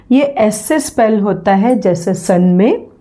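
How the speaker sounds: ordinary talk, nothing marked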